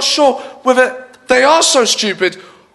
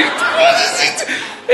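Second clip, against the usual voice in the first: very high, drawn-out disbelief; exasperated squeal; much slower than usual. Real, not acted. Comedian baffled by Joey Essex, exasperated squeal.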